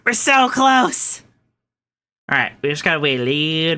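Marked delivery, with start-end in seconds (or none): 0.1-1.2 drawn-out, high, thrilled cry; excited. Real, not acted. To chat near the end, excited and drawn out. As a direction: stretch it out, almost there.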